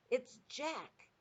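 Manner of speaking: high cry of alarm; a mock bellow without much volume; Flesh Lump Eater. A narrator's reading. Giant spots Jack in his nightmare, acted alarm. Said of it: "bellowed the Flesh Lump Eater".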